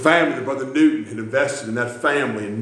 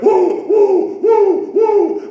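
high, loud joyful whoops; James; 7 dB louder, much slower than usual. Retold or imitated. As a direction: whoop repeatedly like an overjoyed worshipper. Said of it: "and just went".

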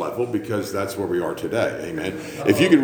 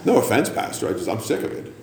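ordinary talk

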